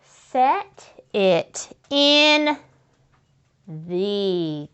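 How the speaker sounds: "Set it in" drawn out very slowly while writing; slow sounding out. Shown as a demonstration. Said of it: "sound it out as you're writing".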